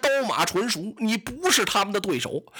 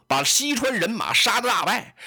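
ordinary delivery, nothing marked

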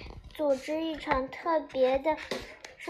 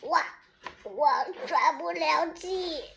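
strained, squeezed-out gasp of words; feeble, breathless voice; weak, out of breath; 4 dB quieter than usual. A narrator's reading. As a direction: struggle to get the words out, short of air. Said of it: "我有气无力地说".

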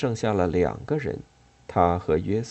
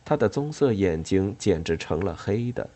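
plain reading voice

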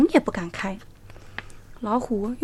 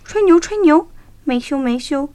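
teasing; 5 semitones higher, 8 dB louder than usual. A narrator's reading. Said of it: "小猴和小兔都在笑话小狐狸".

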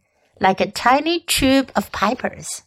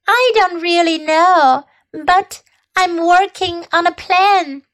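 vague; 7 semitones higher than usual. A narrator's reading. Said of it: "said Charlotte vaguely".

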